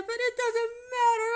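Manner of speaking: high, loud crying protest; tearful. Retold or imitated. Refusing the manager's help, high and crying. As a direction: cry it out. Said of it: "tears are, like, falling down".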